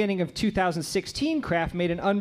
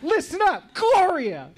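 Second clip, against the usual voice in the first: mock angel shout; 11 semitones higher, 5 dB louder, slower than usual.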